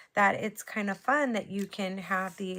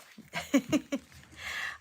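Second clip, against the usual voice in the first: embarrassed giggle; 7 semitones higher than usual. Real, not acted. Laughs after the slip.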